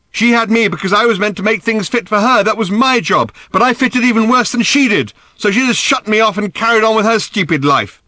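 high, loud, impassioned rant; bitter tirade. A narrator's reading. Daughter blames her absent mother, ranting bitterly. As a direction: pour out the grievance at full pitch.

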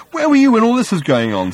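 high, loud challenge; indignant. A narrator's reading. Arthur challenging his rival Thor, indignant. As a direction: demand an answer, pitched right up.

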